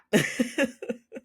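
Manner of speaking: a high rising laugh. Real, not acted. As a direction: let out a squeaky laugh. Laughing at the story.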